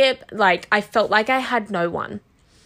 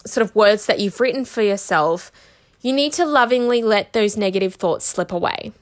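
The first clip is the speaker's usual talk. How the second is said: ordinary talk, nothing marked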